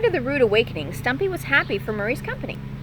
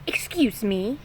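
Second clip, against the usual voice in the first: quick annoyed call; short irritated call from the nest; irritable first call; slower than usual. Acted. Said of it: "yelled Stumpy irritably".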